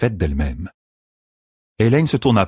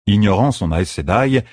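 ordinary delivery

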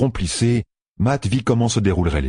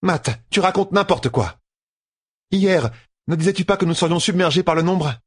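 voix de femme sceptique; 11 semitones higher than usual. A narrator's reading. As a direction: question sceptique, voix plus haute. Amyrlin contests Matt. (voix d'Amyrlin sceptique)

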